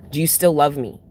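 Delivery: normal talking voice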